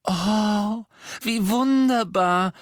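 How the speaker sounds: very high, loud, drawn-out squeal; delighted screech. A narrator's reading. Hermine hearing that Tonks is having a baby, screeching. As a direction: squeal with delight, stretching the words. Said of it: "kreischte Hermine".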